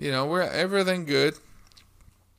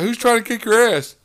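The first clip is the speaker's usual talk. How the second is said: raised, loud playful teasing; joking; 7 semitones higher, 6 dB louder than usual. Retold or imitated. Speaker ribbing his team leader about a threatened fight, joking. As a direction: tease him with a loud, higher-pitched question. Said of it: "Being funny".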